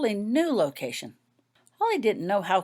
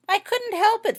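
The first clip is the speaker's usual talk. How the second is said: a much higher, louder shout; shouting back; 6 dB louder than usual. A narrator's reading. Painting shouting back to the distant healer, high and loud. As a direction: yell it back across the field. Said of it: "Fiona shouted back".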